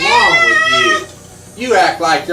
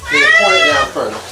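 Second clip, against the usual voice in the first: quick aside; much faster than usual. Real, not acted. Aside to camera holder.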